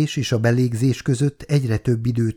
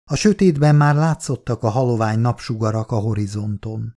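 ordinary delivery, nothing marked

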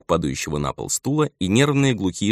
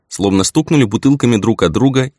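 usual speaking voice

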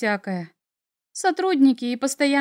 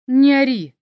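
louder, curt spat-out order; sharp snap; 7 dB louder than usual. A narrator's reading. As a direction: spit the words out sharply. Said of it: "резко выплюнул он".

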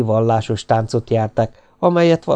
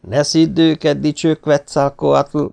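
raised, higher taunting tone; young man's jeer, drawn out on the title; mocking; 6 semitones higher, 4 dB louder, slower than usual. A narrator's reading. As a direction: address him with exaggerated mock respect. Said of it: "mondta a fiatalember gúnyosan".

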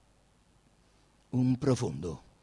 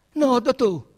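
loud reply; 11 semitones higher, 11 dB louder, slower than usual. Acted. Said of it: "gli risposero".

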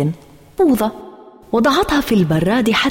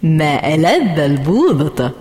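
sly teasing; slower than usual. A narrator's reading. Sister taunting narrator over ice cream, sly and smug. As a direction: tease your sister with every "مم". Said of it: "بكل خبث وتقول".

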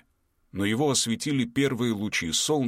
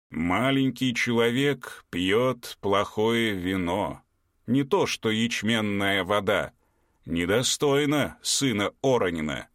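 dim slow booming giant; 2 semitones higher, slower than usual. Acted. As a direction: talk down to the little man. Giant Pekko speaks.

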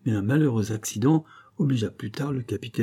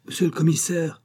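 higher, called out from a window; calling out; 7 semitones higher than usual. A narrator's reading. President calling from the window to the commissioner, raised call. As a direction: shout down to the officer below. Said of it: "cria le président par la fenêtre".

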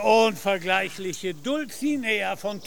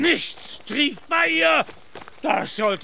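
outraged retort; 8 semitones higher, slower than usual. Acted. Knight rebukes the merchant for insulting his lady, outraged. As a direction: bristle as if his lady's honor were insulted.

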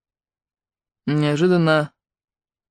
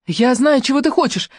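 shouted; 8 semitones higher than usual. Acted. Ruby shouts to Claude across the wind. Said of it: "крикнула Руби".

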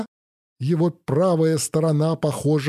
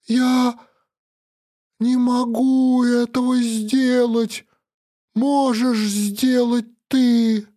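anguished; 8 semitones higher, slower than usual. Acted. Hero breaks down, can't shoot.

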